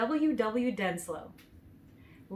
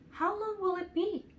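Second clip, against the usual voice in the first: put-on child asking; 11 semitones higher than usual. A narrator's reading. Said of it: "the child asked of the woodsman".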